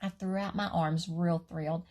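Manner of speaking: usual speaking voice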